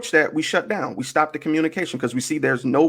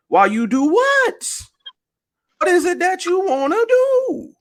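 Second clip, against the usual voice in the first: incredulous challenge; 14 semitones higher than usual. Retold or imitated. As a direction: push the questions way up high, incredulous. Mock challenge to women's demands.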